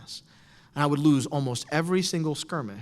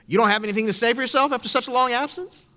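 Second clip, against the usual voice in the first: higher, louder sneering challenge; taunting teacher; 7 semitones higher, 6 dB louder than usual. Retold or imitated. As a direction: call him out in front of the class. Teacher taunting student in class.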